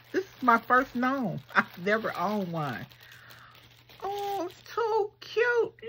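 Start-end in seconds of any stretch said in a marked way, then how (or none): 4.0-5.7 much higher, very slow delighted squeal; high drawn-out gush; excited squeal. Real, not acted. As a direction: swoon over the little gnome. Squealing over first gnome.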